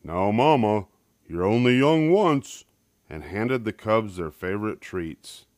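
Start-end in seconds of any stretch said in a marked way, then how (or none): 0.0-2.6 louder, easygoing father voice; smiling Papa Bear. A narrator's reading. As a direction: indulgent dad excusing the cubs. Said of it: "Papa Bear smiled and said".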